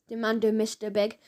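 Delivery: ordinary delivery, nothing marked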